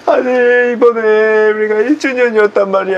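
hammed-up crying while telling the story; tearful whining. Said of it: "왜, 왜 또 오는데?". (울먹이며 하소연)

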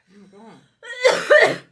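high, loud, stretched laughing shriek; shrieking, laughing oh my God. Real, not acted. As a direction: squeal it out through laughter. Friend watching her eat spicy powder.

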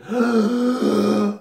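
sharp high gasp for air; mock gasp. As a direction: gasp like surfacing from drowning. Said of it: "take these breaths in".

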